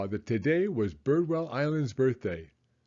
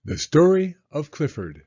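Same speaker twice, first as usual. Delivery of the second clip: louder, announcing the book's title; bold title read; 3 semitones higher, 7 dB louder than usual. A narrator's reading. Narrator announces the title to young listeners, loud and grand. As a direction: present the title with a flourish.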